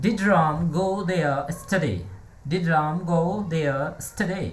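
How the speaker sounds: very slow, word by word model read; slow model sentence. Shown as a demonstration. Teacher models the English question for the class, very slow. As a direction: spell it out slowly so students can repeat. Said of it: "How will we speak? Let's start".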